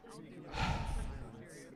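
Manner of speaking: long exhaled sigh; heavy sigh. Real, not acted. Speaker winding down after getting worked up, sighing. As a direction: let the breath out after an outburst.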